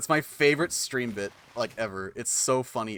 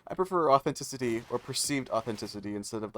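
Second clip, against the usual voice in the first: ordinary delivery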